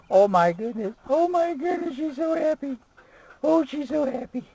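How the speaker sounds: very high, loud, gushing coo. Real, not acted. Vlogger cooing at his happy dog in high baby talk.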